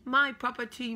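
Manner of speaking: high, loud "My property"; mock possessive call. Shown as a demonstration. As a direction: claim it playfully out loud.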